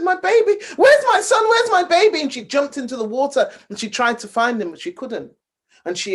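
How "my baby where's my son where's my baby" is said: high, loud panicked cry; frantic mother. Acted. Mother crying out for her missing son at the lake, panicked. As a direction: scream for a lost child.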